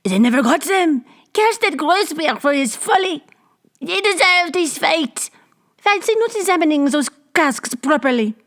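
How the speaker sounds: high, jeering crowd voice; mock the fool with glee. A narrator's reading. Crowd jeers at Grossberg's folly, gleeful.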